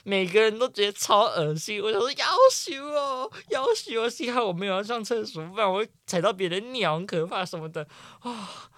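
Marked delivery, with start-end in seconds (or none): 2.1-4.1 high, drawn-out yuck; mock disgusted groan. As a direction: squeal it in disgust. Mocking disgust at toilet scene. (嫌弃地拖长尖叫)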